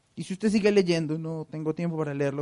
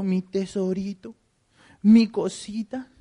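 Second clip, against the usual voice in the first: put-on slow, drawn-out baby talk, higher; doting tender tone; 5 semitones higher, much slower than usual. God cooing to his people as his babies, slow and doting. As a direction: coo over them like little babies. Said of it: "un cambio en el tono de voz de Dios".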